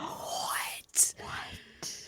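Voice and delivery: breathless mock whisper; whispered what. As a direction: whisper it in awe. Husband in shock, whispered.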